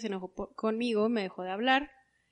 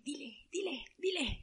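softer urging under the breath; urging herself; 5 semitones higher, 6 dB quieter than usual. Retold or imitated. Psyching herself up to tell mom, softly urging. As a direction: push yourself to speak up, softly.

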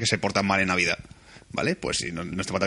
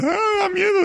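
high wailing child's cry, imitated; child fleeing the cinema in tears, mock wail; scared crying kid. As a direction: squeal it like a frightened little kid. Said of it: "ese niño se fue llorando en plan".

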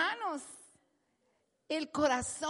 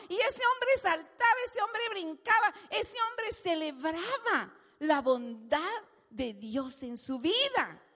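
ordinary delivery

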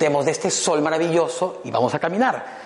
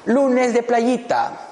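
teasing caption; 10 semitones higher, much slower than usual. Retold or imitated. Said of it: "y pongo".

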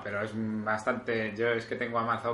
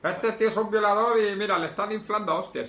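mock outraged forward; 8 semitones higher than usual. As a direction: parody a viral outrage message. Mocking a WhatsApp forward.

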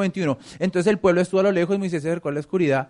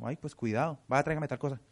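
mom's changed tone; 2 semitones lower, 8 dB quieter than usual. Retold or imitated. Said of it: "¿Cómo cambia la mamá el tono?".

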